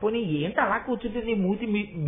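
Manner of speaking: ordinary delivery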